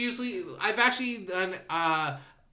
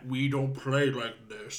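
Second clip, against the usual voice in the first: giant voice; 6 semitones lower, 3 dB quieter, slower than usual. Retold or imitated. Voicing the giant again.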